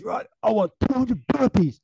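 high, loud hammed-up command; mock Goggins order. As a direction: order it like a drill sergeant.

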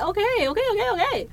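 high-pitched rapid-fire mock agreement; flustered compliance. Retelling own answer to the order to pull out, mock fluster. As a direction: give in, comically flustered.

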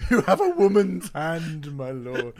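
lightly laughing period voice; mock courtly flattery; Blackadder quote. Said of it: "quoting Blackadder".

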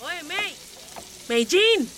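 high-pitched call building to a shout. Acted. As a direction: call a distracted coworker to attention. Calling an inattentive coworker.

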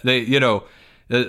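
usual speaking voice

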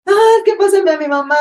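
loud, high-pitched fake wail; hammed-up sobbing imitation; mock crying. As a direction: act out a tearful plea for mamá. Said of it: "así llorando, yo así".

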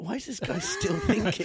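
puzzled high voice. Retold or imitated. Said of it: "is like".

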